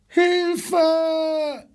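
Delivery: high-pitched, drawn-out cry for help; zebra's cry. A narrator's reading. Zebra calling for help in its pen, crying out high. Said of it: "schrie in diesem Augenblick das Zebra".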